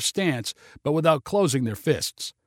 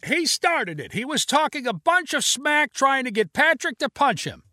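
high, raised bystander shout; 11 semitones higher than usual. Acted. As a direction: call out to the police.

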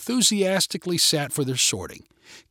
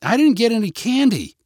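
put-on higher-pitched child's grumble; complaining kid; 9 semitones higher, 4 dB louder than usual. A narrator's reading. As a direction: whine it like a let-down trick-or-treater. Child complains about getting no candy.

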